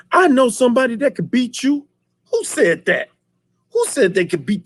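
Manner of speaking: high, put-on villain taunt; mock Shogun boast. Retelling the Shogun's taunt, mocking high voice. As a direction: sneer it like a movie bad guy. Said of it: "He was like".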